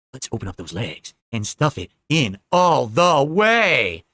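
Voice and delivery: hammed-up sexy kitchen voice, dragged out slowly; mock seductive cook. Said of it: "talking like this".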